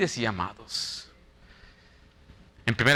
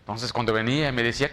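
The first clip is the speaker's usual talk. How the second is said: usual speaking voice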